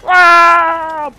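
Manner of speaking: loud high yelp of alarm; startled yelp. Real, not acted. Player caught off guard, loud high yelp. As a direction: yelp as if suddenly hit. Hit in game.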